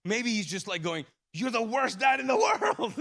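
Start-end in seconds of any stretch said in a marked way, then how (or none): 1.3-2.9 imagined son's accusation. Retold or imitated.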